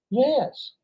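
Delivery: high-pitched, emphatic "yes". Real, not acted. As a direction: lift "yes" right up high. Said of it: "emphatically and incredibly".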